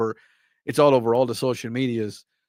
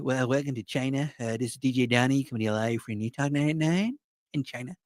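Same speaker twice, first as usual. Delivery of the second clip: a slightly softer, half-hearted radio DJ voice; lightly voiced mock DJ Donny intro; D J Donny impression; 6 dB quieter than usual. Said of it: "how terrible my D J Donny impression is".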